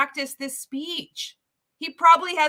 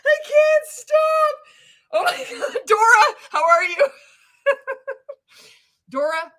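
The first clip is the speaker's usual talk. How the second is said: high, squealing through real laughter; helpless laughter; 11 semitones higher, 6 dB louder than usual. Real, not acted. Greeting Dora after cracking herself up, helpless high laughter. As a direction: barely get it out for laughing.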